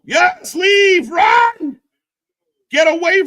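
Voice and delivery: high yelling at the screen; excited shout. Real, not acted. Urging the wrestler to flee, loud excited yelling. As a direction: yell at him to get out.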